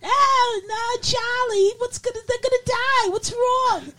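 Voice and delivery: very high falsetto mock Brooklyn angel; Brooklyn accent. Angel frets to Charlie, falsetto Brooklyn accent. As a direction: fret in a squeaky put-on Brooklyn voice. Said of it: "with Brooklyn accents".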